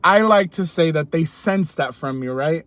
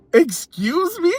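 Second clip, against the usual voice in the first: shocked excuse me; 10 semitones higher, slower than usual. Retold or imitated. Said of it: "I went like".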